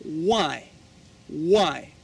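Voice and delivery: WHY said as "wai" with a smooth W; careful teacher's WHY, no rough H; correct pronunciation. Shown as a demonstration. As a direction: model WHY slowly for learners. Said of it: "You have to say".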